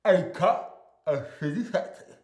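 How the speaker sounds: slow words past a mouth guard; mouthpiece mumble. Acted. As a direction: say it slowly round the mouthpiece. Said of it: "here we go".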